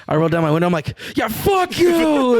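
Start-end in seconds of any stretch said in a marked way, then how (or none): 1.2-2.4 very high, loud, drawn-out holler; re-enacted shout. Driver rolling down the window to join in, full re-enacted shout. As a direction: belt it out, stretching the words.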